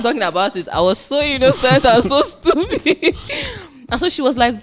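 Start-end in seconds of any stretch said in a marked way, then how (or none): 0.7-3.2 high, loud, breaking into laughter; laughing. Real, not acted. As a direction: laugh at your own silliness. Laughing at her younger self.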